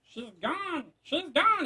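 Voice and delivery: high, thin machine voice; a sudden high robotic alarm; mock computer voice. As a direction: play a puzzled robot. Computer voice noticing user left.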